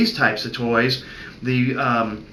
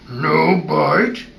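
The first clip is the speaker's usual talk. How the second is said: firm, louder growl; growled correction; 2 semitones higher, 5 dB louder than usual. Shown as a demonstration. Vet stopping a puppy chewing on his hand, firm growl.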